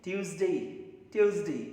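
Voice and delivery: ट्यूसडे as toos-day, hard t; Tuesday repeated. Shown as a demonstration. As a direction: model ट्यूसडे with a light e. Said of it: "ट्यूसडे भी बोल सकते हैं".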